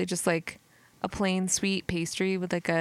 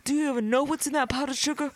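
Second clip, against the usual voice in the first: suspicious agent; 7 semitones higher, faster than usual. Retold or imitated. Acting agent.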